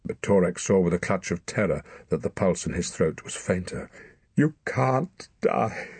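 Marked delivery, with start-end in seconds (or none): 4.4-5.9 slow, high, anguished protest; desperate outburst. Acted. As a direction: burst it out in grief. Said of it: "Torak burst out".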